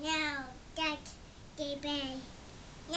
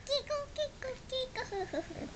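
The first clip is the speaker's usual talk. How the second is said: giggly tickle attack; 7 semitones higher, 5 dB quieter than usual. Real, not acted. Toddler playing tickle game.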